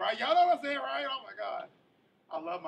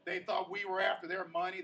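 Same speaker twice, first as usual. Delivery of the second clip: ordinary talk, nothing marked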